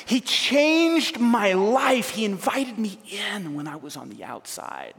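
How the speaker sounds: a preacher mimicking Matthew's excited testimony; imitating Matthew. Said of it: "Matthew's like".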